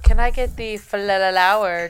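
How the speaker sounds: flalalawer loud, its L repeated as la-la; mock lizard ordering, flalalawer full of extra L's. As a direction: stutter the L inside flalalawer. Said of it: "pronounces every double L as two L's".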